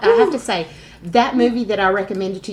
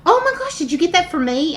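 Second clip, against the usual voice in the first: excited husband; 9 semitones higher, 3 dB louder than usual. Retold or imitated. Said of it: "and he goes".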